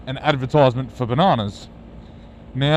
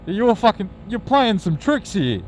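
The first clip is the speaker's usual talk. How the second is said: indignant; 7 semitones higher than usual. Retold or imitated. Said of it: "I'm thinking".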